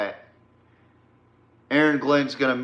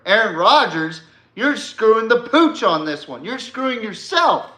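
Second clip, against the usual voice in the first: higher-pitched, emphatic telling-off; exasperated scolding; 6 semitones higher than usual. Real, not acted. Podcaster to Aaron Rodgers on screwing himself, exasperated scolding. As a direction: scold him with rising exasperation. Scolding an absent quarterback directly.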